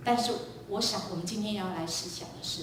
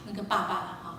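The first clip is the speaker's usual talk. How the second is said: normal talking voice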